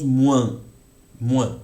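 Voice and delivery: "moins" drawn out, nasal vowel; French nasal moins. Shown as a demonstration. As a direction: stretch "moins" slowly, nasal. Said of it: "es el sonido francés". ("moins" lento con vocal nasal)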